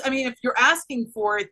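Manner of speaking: normal talking voice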